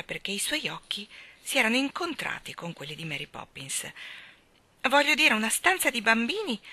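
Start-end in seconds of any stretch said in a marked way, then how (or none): none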